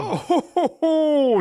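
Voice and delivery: high, booming ho-hos, slow invitation; Santa Claus. Pretend. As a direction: play Santa welcoming the next child. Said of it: "we got another child".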